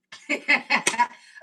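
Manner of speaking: loud, rapid cackling laugh; real laughing. Real, not acted. Cook laughing at her own struggle, cackling laugh. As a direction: burst out laughing at yourself.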